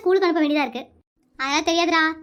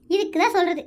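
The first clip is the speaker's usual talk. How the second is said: ordinary delivery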